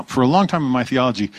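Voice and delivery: ordinary talk, nothing marked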